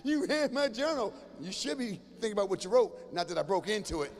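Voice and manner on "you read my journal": higher, slow, wounded accusation; outraged teen. Retold or imitated. Teenager catching a parent reading the journal. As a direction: sound betrayed.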